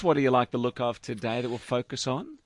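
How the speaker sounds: normal talking voice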